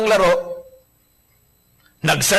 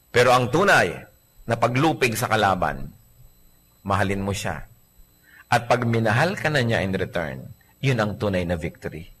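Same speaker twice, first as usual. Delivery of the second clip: lower, softer tender sermon; 8 semitones lower, 5 dB quieter than usual. Acted. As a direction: say it softly and earnestly. Tender solemn point.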